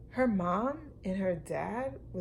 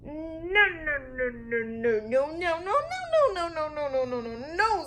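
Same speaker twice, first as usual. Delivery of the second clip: screeching Lulu; 8 semitones higher than usual. Acted. Lulu screeching no at her parents in a tantrum. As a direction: shriek no over and over like a spoiled child. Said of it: "screeched Lulu".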